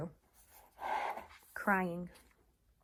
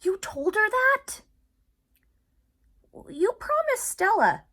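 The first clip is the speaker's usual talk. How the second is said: angry accusation; 12 dB louder than usual. Acted. Ivan glaring at Bob, angry and reproachful. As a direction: accuse him sharply. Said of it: "I glare at him".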